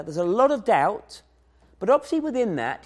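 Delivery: ordinary talk, nothing marked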